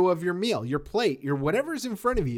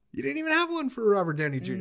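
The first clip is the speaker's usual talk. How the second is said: surprised; 8 semitones higher, faster than usual. Real, not acted. Shocked he picked none.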